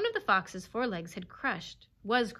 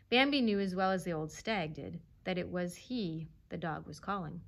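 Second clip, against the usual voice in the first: usual speaking voice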